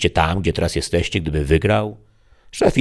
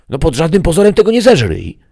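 high, loud devil's alarm; a demon yelling a panicked don't; 12 semitones higher, 8 dB louder than usual. Acted. Demon's warning voice. (przerażony diabeł krzyczy wysoko i głośno)

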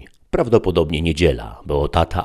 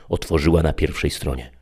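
usual speaking voice

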